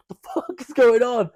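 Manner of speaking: high, loud panicked yell; retold screaming. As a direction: re-enact screaming in confusion. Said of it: "they were like, start screaming".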